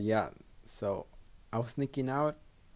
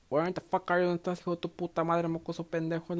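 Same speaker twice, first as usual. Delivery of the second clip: high-pitched imitation of a scolding mother; fast, high mock yelling, not full volume; mock screaming mom; 7 semitones higher, faster than usual. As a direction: imitate mom's tirade, pitched way up. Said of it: "she started screaming".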